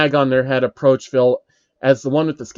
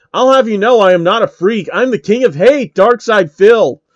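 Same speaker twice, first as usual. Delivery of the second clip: angry; 7 semitones higher, 7 dB louder than usual. Acted. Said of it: "Phil asked angrily".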